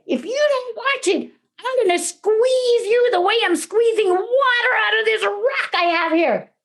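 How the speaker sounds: put-on high, forceful boyish threat; bold threat. A narrator's reading. Youngest brother bluffs the troll with cheese, boldly threatening.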